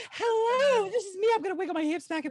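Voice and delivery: high, hammed-up genie greeting; a showy, high-voiced genie introducing itself. Player showing off the genie to the group, high and showy. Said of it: "yeah, which genie?".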